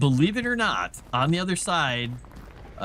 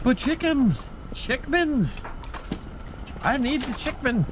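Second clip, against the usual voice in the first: high whiny cat voice begging; voicing the cat; 11 semitones higher than usual. Cat begging owner for chicken, high whiny voice. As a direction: beg for chicken as a silly cat. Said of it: "He's like".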